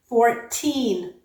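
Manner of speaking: put-on "fourteen" with a crisp t and long stressed TEEN; second syllable stressed. Shown as a demonstration. Teacher contrasting two words, "fourteen" stressed on TEEN with true t. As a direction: hit TEEN in "fourteen", no flap. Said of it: "the second vowel is stressed".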